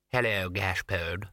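slow, drawling, bored cat; lazy aloof feline voice; snooty bored cat. Acted.

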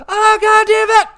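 shrill-free burst of "God damn it"; enemy rage yell. Retold or imitated. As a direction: scream like a gamer who just got killed. Voicing enemy player raging on mic.

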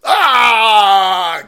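loud, high fake shriek of terror; a hammed-up scream of fright; loud mock scream.

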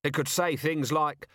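high, worked-up sarcasm; wild-eyed rant. A narrator's reading. Vimes dictating a mock manual, wild exasperated rant. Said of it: "his eyes wild".

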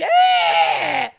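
sudden high loud exasperated yell; frustrated yell. Real, not acted. Host fed up with complaining viewers, exasperated loud yell. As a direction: let out a fed-up shout.

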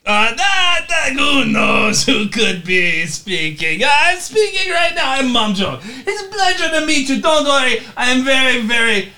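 loud, high, hammed-up lawyer; Mom Joe. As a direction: shout grandly as a theatrical attorney. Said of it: "Was that Mom Joke speaking".

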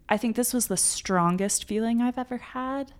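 ordinary delivery

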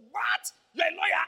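high, loud shout of surprise; shocked disbelief. Retold or imitated. Pastor to the young man learning he's a lawyer, shouting in disbelief. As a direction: blurt it out, astonished. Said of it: "I said".

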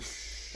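soft breath of a shush; group shushing. Retold or imitated. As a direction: imitate everybody shushing, very softly. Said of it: "Everybody's like".

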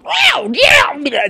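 very high, loud cartoonish voice; heightened high voice. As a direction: squeal it like a cartoon character. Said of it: "That's what I think I sound like".

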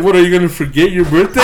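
loud, high scoffing question; amused, hammed-up disbelief; mock incredulity. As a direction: ask it like an exasperated mother, laughing. Mom's incredulous retort, voiced.

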